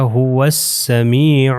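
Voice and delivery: ہو السمیع as "huwas-samee'u", alif and lam silent; ہو السمیع run together, long "ee"; waw joined to seen. Shown as a demonstration. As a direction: slowly recite ہو السمیع with a doubled s. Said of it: "ہم نے اس کو واو کو ملا دینا ہے یہ سین سے".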